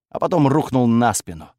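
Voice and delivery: ordinary delivery, nothing marked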